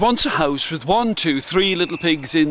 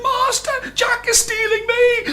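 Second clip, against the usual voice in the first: shrill high-pitched cry, much higher; harp screaming. A narrator's reading. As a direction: shriek for help like a tiny harp. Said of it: "still it screamed".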